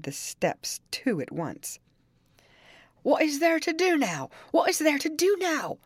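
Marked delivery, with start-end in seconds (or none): 3.0-4.3 high frantic cry, far voice; old servant in terror, voice pitched up; panicked old servant. A narrator's reading. Joseph panicking on the steps. 4.5-5.8 even higher, more desperate repeat; panicked old servant. A narrator's reading.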